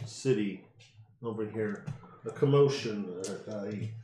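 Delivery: ordinary delivery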